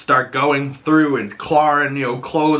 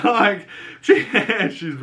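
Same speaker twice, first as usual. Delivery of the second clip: real giggle through the words; laughing; 10 semitones higher than usual. Real, not acted. Vlogger cracking up at Agatha, laughing. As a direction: laugh while talking, pitched up.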